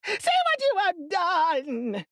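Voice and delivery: high, slow wailing scream; witch screaming. A narrator's reading. Melting witch screaming at Dorothy, high and slow. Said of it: "she screamed".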